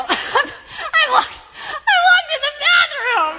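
high, loud wail through laughter; re-enacted helpless laughing yell; laughing hard. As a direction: cry it out, cracking up. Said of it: "I'm laughing so hard at the irony".

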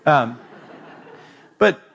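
normal talking voice

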